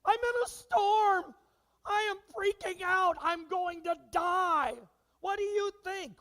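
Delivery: high, loud, slow panicky wail; hammed-up panic, voice pitched high and stretched; panicked complaining. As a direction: overplay a friend melting down on the phone. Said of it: "get somebody on the phone to complain".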